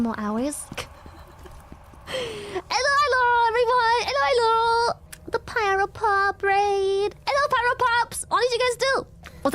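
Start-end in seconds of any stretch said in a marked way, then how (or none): 2.1-9.1 very high, squeaky welcome; excited greeting. Real, not acted. Streamer saying hello to Pyro Pop raiders, excited squeal.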